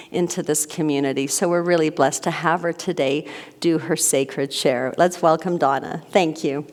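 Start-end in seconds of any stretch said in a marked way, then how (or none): none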